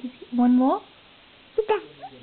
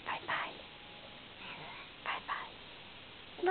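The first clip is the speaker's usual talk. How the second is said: soft whisper, much softer; whispered bye-bye; 18 dB quieter, much slower than usual. Real, not acted. Parent whispering goodbye to her little boy, hushed. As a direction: breathe it out like a secret.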